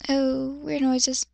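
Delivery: puzzled murmur. Real, not acted. Startled aside mid-reading.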